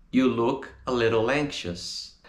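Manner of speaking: "little anxious" run together as one; linked up. Shown as a demonstration. Teacher repeats the answer with words linked.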